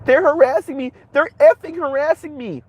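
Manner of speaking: high, whiny imitation of a victim; mock distressed woman. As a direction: squeal it like a wronged damsel.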